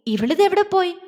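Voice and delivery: high, puzzled question; bewildered. A narrator's reading. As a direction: wonder in surprise. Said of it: "അവൾ അമ്പരപ്പോടെ".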